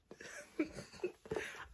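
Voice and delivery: high breathless giggle; giddy whispered giggle. Real, not acted. Giggling to the camera over the book's ending. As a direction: let a giddy laugh slip out quietly.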